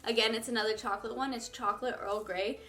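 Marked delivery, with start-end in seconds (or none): none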